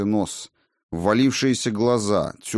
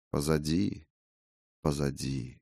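fading echo; 5 semitones lower, 8 dB quieter than usual. Acted. Lulling voice fading away.

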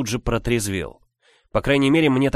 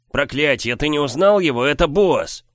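shouting; 6 semitones higher, 4 dB louder than usual. Acted. Continues yelling at brother.

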